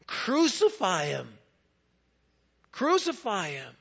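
loud, slow, drawn-out cry; mob scream. Retold or imitated. The crowd demanding Jesus be crucified, loud slow cry. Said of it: "screamed out".

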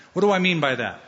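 ordinary talk

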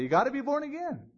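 high-pitched imitation of a repetitive preacher; insistent mock evangelist plea. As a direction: parody the same old altar call, pitched high. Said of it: "they've been preached to for too long".

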